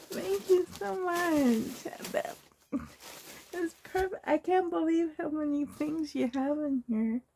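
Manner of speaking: real happy tears choking the words; tearful thanks. Real, not acted. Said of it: "I got tears in my eyes".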